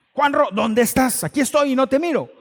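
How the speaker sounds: reclámale fuerte, impaciente; llamada enojada. Retold or imitated. (gritando enojado al teléfono)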